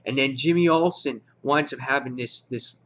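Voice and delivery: normal talking voice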